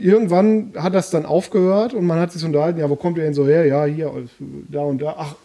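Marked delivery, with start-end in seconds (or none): none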